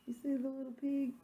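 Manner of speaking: usual speaking voice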